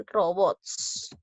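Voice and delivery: "Robots" drawn out as Ro-bots; teacher syllabifying "Robots"; syllable by syllable. Shown as a demonstration. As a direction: split "Robots" slowly into two syllables. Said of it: "Vamos a silabear".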